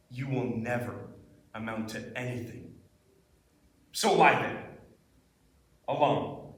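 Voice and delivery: intensity dropped low and soft; low, quiet, heavy dramatic delivery with long pauses; intense monologue. Acted. Actor alone, talking to themselves in a monologue, intense and hushed. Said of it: "This was a really intense monologue".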